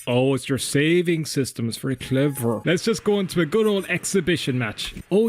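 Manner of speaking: ordinary talk, nothing marked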